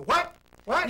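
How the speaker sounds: loud, high-pitched questioning call; mock hard-of-hearing 'what?'; can't hear you. Listener who cannot hear speaker.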